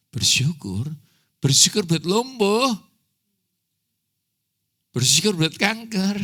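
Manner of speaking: can't believe he's asked to give thanks; incredulous protest. Retold or imitated. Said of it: "Terus bilang".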